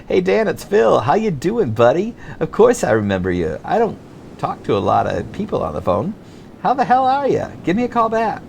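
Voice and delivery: easygoing beach dude; surfer voice. Retold or imitated. Phil on the answering machine, lightly voiced surfer. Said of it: "switched to a surfer light".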